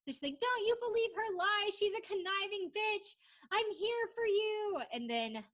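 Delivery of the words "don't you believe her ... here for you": high-pitched imitation of a screaming reality contestant; hammed-up pleading yell, not full volume; mock screaming. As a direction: plead shrilly as if yelling upstairs, in mock earnest. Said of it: "Vanessa's, like, screaming".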